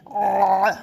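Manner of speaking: آ pressed from the throat, held; long throaty آ; throat vowel. Shown as a demonstration. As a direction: squeeze آ evenly in the throat. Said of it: "اس طرح سے".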